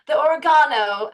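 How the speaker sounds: mock British accent. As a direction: call out oregano in a put-on British accent. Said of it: "we're just like".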